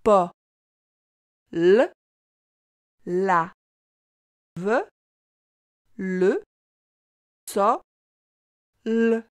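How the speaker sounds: "Pa, l, la, ve, le, so, l" in separate sounds with long pauses; sounded out slowly. Shown as a demonstration. Teacher reading a sentence with the child, sound by sound. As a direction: decode piece by piece, holding each "l" as a sound. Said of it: "Essaie de lire cette phrase avec moi".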